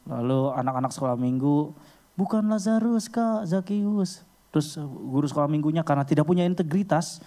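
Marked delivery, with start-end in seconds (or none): 2.1-4.2 put-on high, drawn-out kids' chorus; Sunday-school children correcting. (anak sekolah minggu mengoreksi, nada tinggi)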